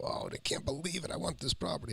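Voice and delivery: high, thrilled gasp of wanting; a rush of excitement at seeing the sign; excited. Retold or imitated. Retelling excited reaction to sale sign.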